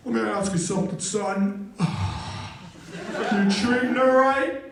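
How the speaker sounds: very slow, heaving delivery, imitated; hammed-up winded coach, noisy breaths; out-of-breath gym teacher. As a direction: gasp and huff around the question. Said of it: "sounded like he was out of breath".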